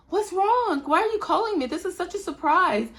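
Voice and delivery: high, sing-song fake innocence; mock innocent surprise. Mom feigning surprise to principal.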